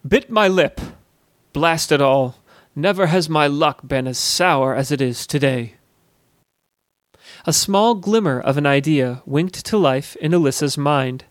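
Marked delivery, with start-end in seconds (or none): none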